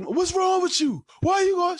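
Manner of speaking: high, loud imitation of pestering yells; mock yelling. Man hounding a partner who won't talk, mock yelling. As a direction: yell it very high, hammed up. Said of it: "you're yelling".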